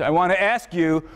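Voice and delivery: usual speaking voice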